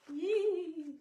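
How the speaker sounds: sudden high joyful squeak; excited squeal. Acted. Mother reading to her kid squeals as the dogs meet, excited. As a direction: squeal with delight at the dog reunion.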